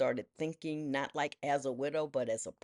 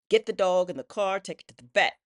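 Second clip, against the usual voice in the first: urgent declaration; 5 semitones higher, 9 dB louder than usual. Retold or imitated. Urgent decision in emergency.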